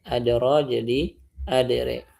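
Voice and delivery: adra said as adere with imala; imalah reading. Shown as a demonstration. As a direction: stretch adra into adere, the a bending toward e. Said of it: "dia dibacanya".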